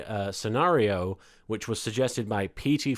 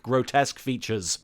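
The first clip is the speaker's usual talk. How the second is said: plain reading voice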